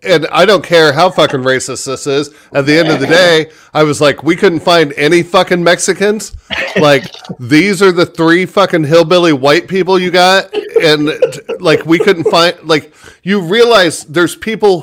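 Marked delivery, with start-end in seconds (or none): 4.2-10.5 retold rant. Ranting retold complaint.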